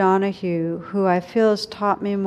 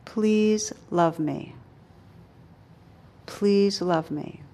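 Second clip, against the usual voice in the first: tender plea; slower than usual. Retold or imitated. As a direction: plead gently and slowly, like asking a mother for love. Dying woman's plea voiced.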